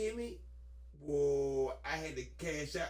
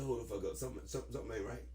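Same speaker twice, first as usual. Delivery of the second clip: low quiet retold warning; 9 semitones lower, 7 dB quieter, much faster than usual.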